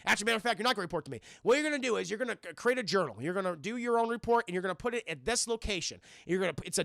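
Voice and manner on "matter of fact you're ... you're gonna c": ordinary delivery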